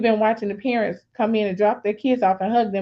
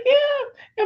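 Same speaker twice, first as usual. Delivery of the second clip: very high, squeaky yeah; retold high-pitched confirmation; emotional yes. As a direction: act out an overwhelmed mom squeaking agreement. Emotional answer to son, retold.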